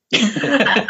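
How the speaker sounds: amused burst of laughter; real laugh. Real, not acted. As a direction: crack up at your own confession. Laughs at own broken vow.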